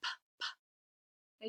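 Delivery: bare voiceless "p", said twice; two short pops of "p"; letter sound P. Shown as a demonstration. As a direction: puff out "p, p" with no vowel. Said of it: "Does that sound like p".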